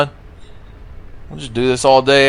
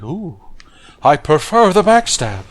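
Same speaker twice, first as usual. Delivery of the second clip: higher put-on character voice; Miguel; 6 semitones higher than usual. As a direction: lift the pitch for a put-on reply to the ghost. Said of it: "And Miguel's like".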